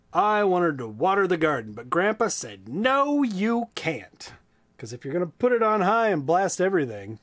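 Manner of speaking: lively, high storybook voice; reading to children. A narrator's reading. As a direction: read it big and bright for kids. Reads a children's book aloud.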